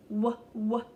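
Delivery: "w" as two separate "wuh" sounds; letter sound demo. Shown as a demonstration. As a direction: punch out "wuh, wuh" before the word. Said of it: "wagon have the w".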